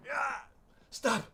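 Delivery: high, pained cry for mercy; shocked pleading. Acted. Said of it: "turns around in shock".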